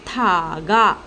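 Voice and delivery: thaga in two long syllables, tha then ga; teacher blending tha and ga into thaga; sounded-out word. Shown as a demonstration. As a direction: stretch both vowels of thaga slowly. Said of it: "so it becomes thaga".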